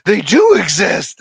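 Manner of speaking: loud mock astonishment; big, stunned commercial quote; M&M's commercial impression. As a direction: imitate a startled cartoon candy from an advert. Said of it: "M&M's Christmas commercial".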